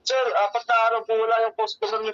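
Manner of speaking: ordinary delivery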